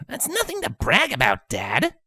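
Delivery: high, dismissive retort to dad; scoffing. A narrator's reading. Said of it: "Rainbow scoffed".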